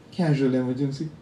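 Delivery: ordinary talk, nothing marked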